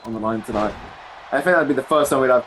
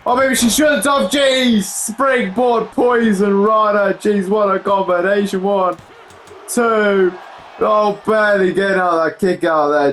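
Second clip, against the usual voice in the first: higher, shouting the near fall as it happens; excited play-by-play; 6 semitones higher, slower than usual. Real, not acted. Commentator shouting the springboard Poison Rana and count. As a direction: get swept up in the count and yell it. Live call of a big combination.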